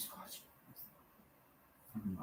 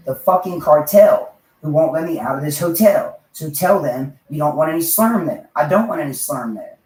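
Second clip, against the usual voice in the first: loud, higher ranting yell at the cartel; 5 semitones higher, 9 dB louder than usual. Retold or imitated. Man yelling back at a cartel that traps him, loud and high. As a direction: bellow it out, worked up and reckless. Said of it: "So I yelled at it then".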